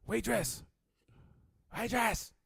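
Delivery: high calls across the room; mock bar-room call. Friend calling waitress loudly.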